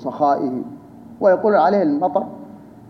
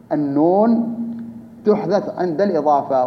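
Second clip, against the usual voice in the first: slower, with a pause after "النون"; measured restatement. Real, not acted. Teacher restating a grammar rule to students, measured and spaced out. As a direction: set "النون" apart on a rise, then give the rest evenly.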